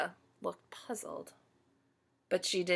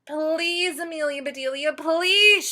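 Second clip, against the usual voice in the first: high, loud pleading shout; shouting Grizzlies; 8 semitones higher, 6 dB louder than usual. Acted. Grizzlies shouting at their batter, loud pleading. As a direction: beg her at the top of your voice. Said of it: "shouted the Grizzlies".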